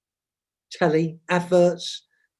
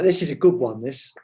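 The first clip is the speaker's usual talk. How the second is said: ordinary talk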